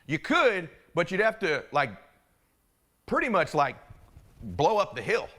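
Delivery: lightly voiced, halting country local; good old Idaho boy. Local contractor hedging his advice to the landowner, lightly voiced Idaho boy. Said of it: "one good old Idaho boy comes by".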